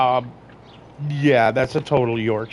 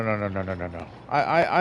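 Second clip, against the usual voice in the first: ordinary talk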